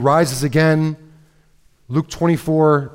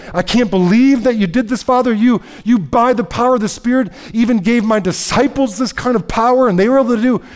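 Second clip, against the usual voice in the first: higher and louder, overjoyed and amazed; a son marvelling to his Father, hands up; joyful Jesus praying; 8 semitones higher, 4 dB louder than usual. Retold or imitated. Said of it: "he's happy and he's like".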